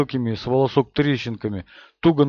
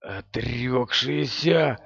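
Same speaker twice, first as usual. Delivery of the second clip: hoarse, slow and hushed; 5 dB quieter, much slower than usual. A narrator's reading. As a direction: rasp the word out in dread. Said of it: "сказал Мэтт хрипло".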